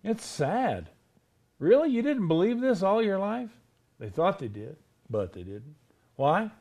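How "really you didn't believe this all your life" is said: high-pitched mock astonishment; incredulous surprise. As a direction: question it in disbelief, starting high. Acts out astonishment at lifelong Christians.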